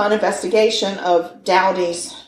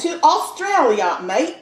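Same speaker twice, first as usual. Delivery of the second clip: hammed-up Aussie accent, pitched up; Australian accent; 7 semitones higher than usual. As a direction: put on a proud mock Australian twang. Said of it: "that's a really good accent right there".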